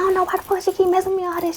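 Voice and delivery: high, fast, exasperated retort; quick high-pitched mock protest; retold angry outburst. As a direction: rattle it off, fed up with the guard. Retold argument with prison guard.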